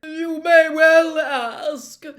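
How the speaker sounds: high, loud, slow and shrill; shrill, drawn-out and affronted. A narrator's reading. Trelawney answering Harry's question, shrill and affronted. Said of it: "she said shrilly".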